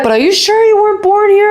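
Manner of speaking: a mock disbelieving question tossed out quickly; the Cubans in Cuba doubting she's American, quick surprised. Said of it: "They were like".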